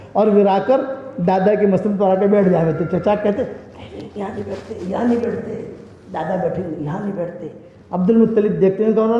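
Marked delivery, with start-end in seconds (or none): 3.4-7.5 hushed uncles. Acted. Uncles gently stop the boy.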